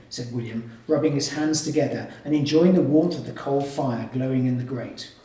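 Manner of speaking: usual speaking voice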